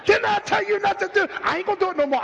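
high, loud angry parent; scolding parent. Retold or imitated. Imitating a parent scolding a child, high and loud. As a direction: bark it like a mad mother.

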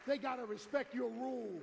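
emphatic repeat of the point. Real, not acted. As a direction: drive the rule home. Preacher stressing the rule.

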